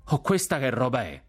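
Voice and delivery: a touch of scandalised surprise; indignant exclamation. A narrator's reading. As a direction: exclaim in affronted disbelief.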